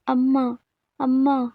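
a small child's plaintive call, mildly acted; crying child. A narrator's reading. Crying boy calls for his mother, mildly tearful. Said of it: "బాబు ఏడుస్తూ కనిపించాడు".

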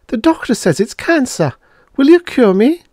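very high, pleading patient voice; anxious plea. A narrator's reading. Patient tells Liff it's cancer, anxiously pleading. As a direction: plead for a cure, pitched right up. Frightened patient begs for cure.

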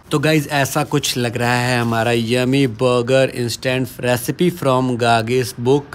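usual speaking voice

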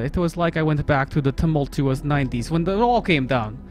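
put-on lightly held Russian accent reading the game text. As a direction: narrate the story in mock Russian.

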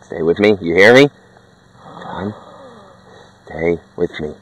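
low, soft desperate begging; begging a dying friend not to go; quiet pleading. Acted. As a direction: plead under your breath as he slips away.

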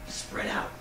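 hushed stage whisper to companions. Acted. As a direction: whisper a furtive order to your party. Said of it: "he turns to his colleagues and he whispers".

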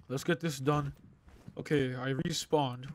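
normal talking voice

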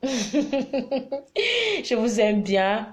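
laughing through a slow, drawn-out je vous aime bien. Real, not acted. Vlogger laughing as she tells her viewers she loves them. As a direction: let the laugh carry the words, stretched very slow.

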